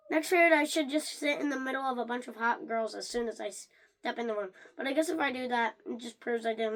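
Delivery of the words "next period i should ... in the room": ordinary delivery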